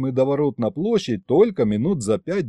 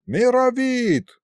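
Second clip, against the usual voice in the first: high, long-held call; summoning call; 11 semitones higher, slower than usual. A narrator's reading. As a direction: holler the name out, stretched long. Summons the other sorcerer. (зовёт протяжно, высоко)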